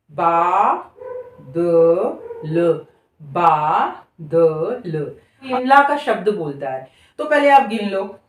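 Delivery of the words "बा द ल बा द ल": "बा द ल" split and said very slowly; syllable-by-syllable demo. Shown as a demonstration. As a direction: sound out each syllable with pauses. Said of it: "वही तरीका". ("बा द ल" को रुक-रुककर पढ़ना)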